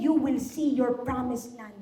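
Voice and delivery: ordinary talk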